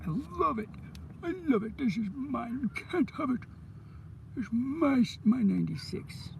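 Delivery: squealing mine, mine; possessive gushing. Real, not acted. Gushing over coin, possessive.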